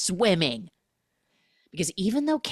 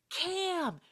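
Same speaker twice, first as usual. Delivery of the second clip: screaming; 13 semitones higher, 5 dB quieter, much slower than usual. Retold or imitated. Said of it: "She's screaming".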